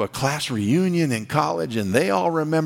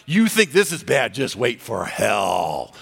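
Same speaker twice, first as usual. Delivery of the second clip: mock-menacing taunts, punched then drawn out; gloating judge. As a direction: pounce on the sinner, then slow down on the threat. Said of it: "He's gonna say".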